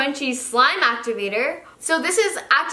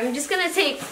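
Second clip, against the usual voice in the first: usual speaking voice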